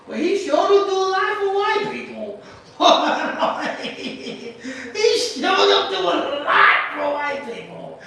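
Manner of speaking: laughing through the words; real laughter breaking in, then back to the line; can't help cracking up. Real, not acted. Laughing at his own joke.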